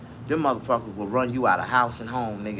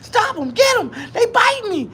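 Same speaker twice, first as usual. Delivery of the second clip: much higher, pleading panicked cry climbing with each phrase; panicked plea. Retold or imitated. Exterminator fleeing the apartment, high panicked pleading cry. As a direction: beg for rescue as the bugs bite. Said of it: "talking about".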